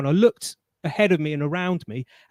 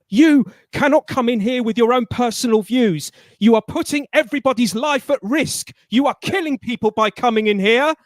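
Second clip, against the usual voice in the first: higher, louder accusing shout; imitating the manager yelling and pointing; retold shouting; 6 semitones higher, 6 dB louder than usual. Manager shouting at him as he leaves: higher, louder retold shout. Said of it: "she's behind me shouting and following me".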